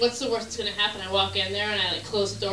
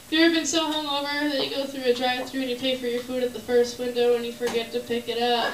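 ordinary talk, nothing marked

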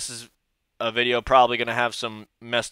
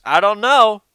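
skeptical; 9 semitones higher, 10 dB louder than usual. Real, not acted. Skeptical repeated doubt.

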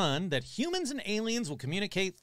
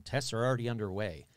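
normal talking voice

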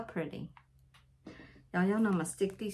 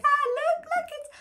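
much higher, retold squeal of pure excitement; excited squeal; 4 dB louder, faster than usual. Retelling spotting a sea turtle while fishing, high excited squeal. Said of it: "I was like".

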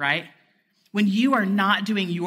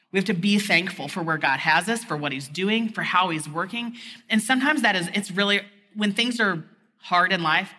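ordinary talk

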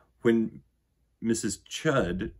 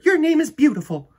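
loving mother; 12 dB louder than usual. Acted. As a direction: sing out a welcome to her child. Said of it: "said her mother".

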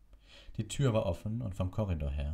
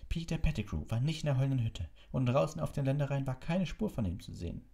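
adamant teacher; 9 semitones higher than usual. Acted. Figure voice of adamant Snape.

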